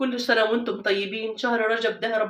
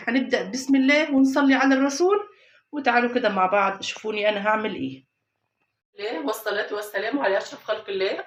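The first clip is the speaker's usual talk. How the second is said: normal talking voice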